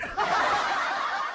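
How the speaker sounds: quiet airy chuckle under the breath; a private little laugh; soft breathy laugh. Real, not acted. Vlogger chuckling to viewers, soft breathy laugh.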